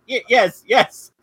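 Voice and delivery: high, loud, panicky stammering yes; nervous stammer. Retold or imitated. Wanda nervously agrees with her neighbour, squeaky panicked stammer. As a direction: squeak it out, caught in a lie. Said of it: "And Wanda's like".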